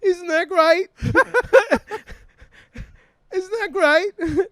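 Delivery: high, cheery mum voice; mom's voice. Retold or imitated. Mum beaming at daughter's hookup, high and chirpy. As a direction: gush it like a delighted mum.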